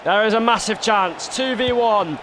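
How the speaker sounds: live football commentator voice; excited stadium commentary; play-by-play commentary. Acted. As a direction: call the match like a TV pundit.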